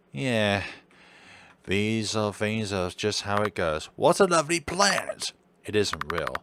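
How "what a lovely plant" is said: higher, delighted doctor voice; raised-pitch doctor admiring the room; doctor character voice. Acted. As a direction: gush about it like the genteel doctor. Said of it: "I'm gonna drop this voice".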